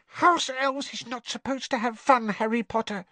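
very high squeaky voice, set and firm; firm elf squeak. Acted. Winky correcting Harry about elves, firm squeak. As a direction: lay down the rule. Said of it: "said Winky firmly from behind her hands".

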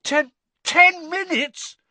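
high, slow stunned repeat; panicked stammer. Acted. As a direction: stammer it in shock. Harry panicking.

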